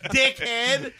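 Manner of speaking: loud, high hammed-up scolding; mock angry tirade. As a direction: play an agent laying down the law, laughter around. Voices FBI berating the suspect.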